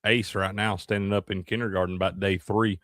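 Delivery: normal talking voice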